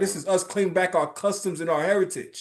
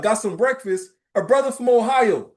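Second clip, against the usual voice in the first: ordinary talk, nothing marked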